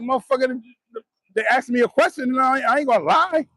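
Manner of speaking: high-pitched mock indignation; re-enacted high, incredulous retort; retold indignant comeback.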